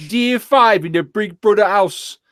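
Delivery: put-on high, slow Geordie accent; Geordie announcer impression. Mimicking the Big Brother narrator, exaggerated Geordie accent. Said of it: "Batman's a Geordie".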